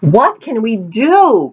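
slow, high pleading cry; worried cry. A narrator's reading. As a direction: cry out in worry, slowly. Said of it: "cried the people".

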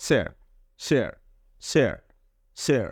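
স্যার starting with a clear স, no r at the end. Shown as a demonstration. Teacher drilling the word for students, clearly. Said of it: "উচ্চারণটা স এর মতো হয়".

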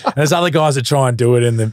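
ordinary delivery

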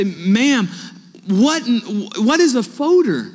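high and loud, baffled; confused loud question. Retold or imitated. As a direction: call out the question in honest confusion. Asking teacher in class, confused.

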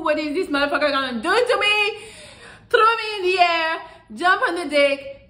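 high, loud, laughing mock excitement; mock excited squeal. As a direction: ham up a girl getting giddy. Girl's excited inner thoughts.

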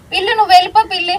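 fast, loud, high shooing. Real, not acted. Mother shooing the cat away to calm her scared daughter.

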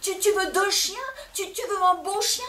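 loud, high frantic bargaining; husband yelling offers to win his wife back; hurling. Acted. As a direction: yell your offers after her. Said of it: "Et il hurlait".

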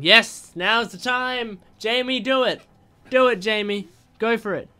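high, loud encouragement; rally him to act now; excited cheer for Jamie. Real, not acted.

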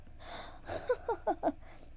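high, airy acted laugh. A narrator's reading. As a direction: burst out in helpless laughter. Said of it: "the woodsman started to laugh".

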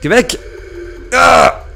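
high, loud scared yell; frightened shout. Real, not acted. Gamer shouting at the librarian in fear. Monster close.

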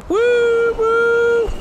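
very high gleeful whoops; excited whoops. Real, not acted. Gamer rising up in the balloon, high whoops.

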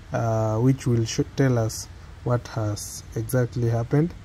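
ordinary delivery

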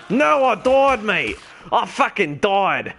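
high, loud cry of dismay; frustrated outburst. Real, not acted. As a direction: yell it as you realise you died. Character just died in game.

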